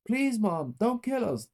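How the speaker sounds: very high begging cry; crying plea. A narrator's reading. Boy to the mother with the gun, begging. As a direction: beg for your life. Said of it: "Ted cried".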